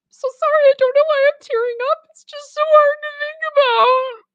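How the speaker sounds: high, loud, hammed-up weepy voice; mock tearful. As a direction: overplay a bride-to-be choking up. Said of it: "and it would be".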